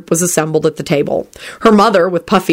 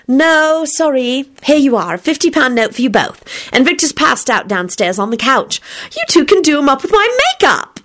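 put-on young woman voice; 8 semitones higher than usual. Rosie's voice in story.

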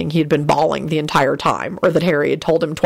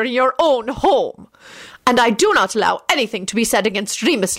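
raised-pitch, measured, firm ruling; stern headmistress; 6 semitones higher than usual. Acted. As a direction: deliver a firm verdict to a pupil.

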